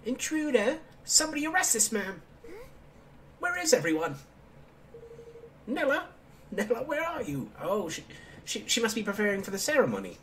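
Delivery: put-on high indignant child king; bratty kid king. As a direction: squeal like a spoiled little king.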